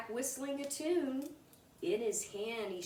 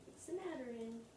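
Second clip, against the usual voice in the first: soft aside; 2 semitones higher, 10 dB quieter, faster than usual. Real, not acted. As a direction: gently check on the little one. Aside to child.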